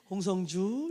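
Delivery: low, gentle name call; alto voice. Shown as a demonstration. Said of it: "그 엘토 좋아해요". (알토로 낮게 부르는 이름)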